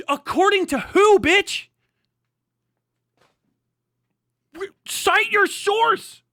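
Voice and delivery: high, loud, slow angry demand; real rage at an uncited claim; furious shout. Real, not acted. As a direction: bellow the demand for a source.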